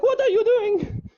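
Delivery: slow falsetto mock outrage; affronted woman. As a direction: imitate a scandalised mother, very high. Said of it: "and she's like".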